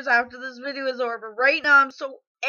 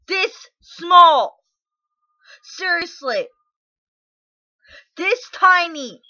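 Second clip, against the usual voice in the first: loud, higher shout; 5 semitones higher, 7 dB louder, slower than usual. Real, not acted. Grieving mother shouting at a commenter how small they were. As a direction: yell it out in grief.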